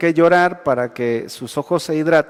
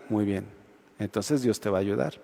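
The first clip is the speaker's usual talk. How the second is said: quiet reassurance; 9 semitones lower, 11 dB quieter than usual. Retold or imitated. Pastor answers Juanito.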